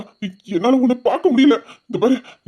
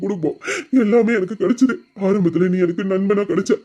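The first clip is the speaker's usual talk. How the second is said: normal talking voice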